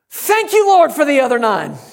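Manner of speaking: high, loud joyful shout of thanks. Retold or imitated. Preacher retelling his stubbed toe, shouting thanks. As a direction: shout it out in praise through the pain. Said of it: "I shouted".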